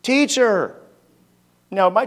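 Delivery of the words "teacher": desperate call. Acted. Disciples wake Jesus in storm.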